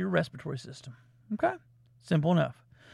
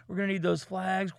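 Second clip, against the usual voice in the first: ordinary talk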